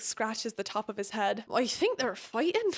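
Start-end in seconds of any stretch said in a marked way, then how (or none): 1.5-2.7 a lightly voiced Riddick in an unplaceable accent; a vague unplaceable accent, lightly put on; odd accent. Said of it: "I don't even know what accent that was".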